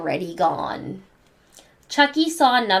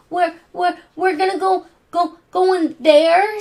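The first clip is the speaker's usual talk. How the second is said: much higher, trembling stutter; scared stammer; 9 semitones higher, 4 dB louder than usual. Acted. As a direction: stammer it in fear. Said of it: "stammered Chucky".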